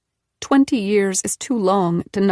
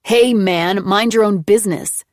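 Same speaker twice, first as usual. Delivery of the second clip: louder sneering mimicry of him; mocking mimic; 6 dB louder than usual. A narrator's reading. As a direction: parrot him back mockingly. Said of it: "Nick mimicked".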